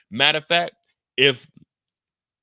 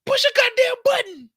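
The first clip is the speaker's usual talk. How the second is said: much higher, loud bark; shouted order; 7 dB louder than usual. Retold or imitated. Someone ordering the button pushed, high loud shout. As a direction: yell the order. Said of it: "as soon as he said".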